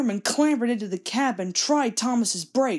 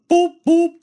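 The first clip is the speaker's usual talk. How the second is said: much higher, very loud whistle-like "boop, boop"; whistling boop; 9 semitones higher, 11 dB louder than usual. Acted. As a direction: toot it like an engine whistle. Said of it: "Gordon whistled".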